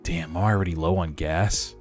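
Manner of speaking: normal talking voice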